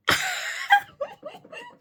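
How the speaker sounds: shrieking giggle way up high; high squealing laugh. Real, not acted.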